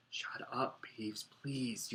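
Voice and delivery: urgent hushed whisper. Acted. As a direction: whisper it so nobody hears. Pleading whisper to Peeves.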